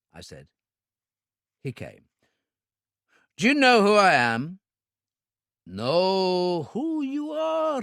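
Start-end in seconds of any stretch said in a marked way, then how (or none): 3.4-4.6 louder, higher threatening demand; menacing question. A narrator's reading. Gunman demands the owner know him, loud menace. Interrogation at gunpoint. 5.6-7.8 high, slow feigned confusion; puzzled villain. A narrator's reading. Owner fakes puzzlement to the gunman, slow higher voice.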